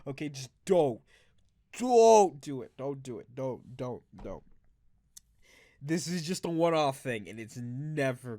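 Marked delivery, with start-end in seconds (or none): 1.8-2.7 firm louder warning. Real, not acted. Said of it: "I cannot stress this enough". 2.8-4.4 dropping to a quiet murmur. Real, not acted. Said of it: "I cannot stress this enough".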